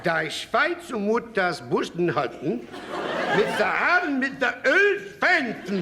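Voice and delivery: slow mock German rant; drawn-out fake German phrasing. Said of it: "In German".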